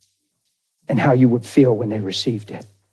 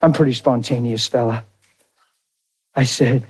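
normal talking voice

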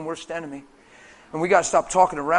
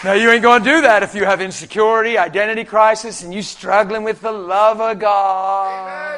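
full-force preaching; 3 semitones higher, 7 dB louder than usual. Real, not acted. Preacher warns congregation about insecurity and identity crisis, forceful preaching. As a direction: press hard on the congregation, stretching the last words.

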